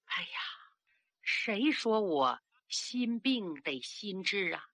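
a frail sick princess, slow and faint; barely enough breath to push the words out, slow; breath faint as gossamer. Acted. The sick old princess stirring to question the girl, slow and faint. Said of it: "气若游丝".

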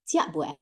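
驾不哎 said as Teochew for 吃了没有; Teochew dialect. Shown as a demonstration. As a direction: say 驾不哎 low and soft, the Teochew way. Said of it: "我们会说".

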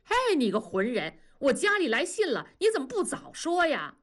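high-pitched exasperated protest; climbing, vexed complaint; indignant outcry. A narrator's reading. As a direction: rail at a workmate in mild outrage. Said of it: "气得大叫".

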